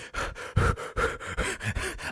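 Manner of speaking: a high unvoiced strained sigh; breathless exhale. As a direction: let out air like you're dying of held-in laughter. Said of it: "we're like".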